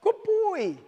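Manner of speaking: high sing-song praise; praising the dog in a high voice. Shown as a demonstration. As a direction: lift the praise way up. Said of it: "Every time I say".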